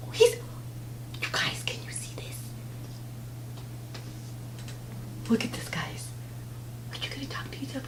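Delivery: hushed excited whisper. Real, not acted. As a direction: whisper so the bird isn't startled. Whispers not to disturb bird.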